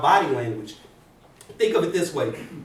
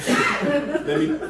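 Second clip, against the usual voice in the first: laughing; 11 semitones higher than usual. Real, not acted.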